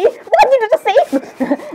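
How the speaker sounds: high, drawn-out mock shock; shocked disbelief. Character reacting to Nifty's words, shocked disbelief.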